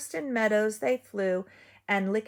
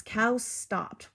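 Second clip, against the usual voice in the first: ordinary delivery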